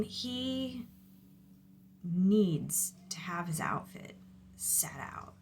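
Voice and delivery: confiding whisper. Real, not acted. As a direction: lean in and whisper it. Confiding about one kid.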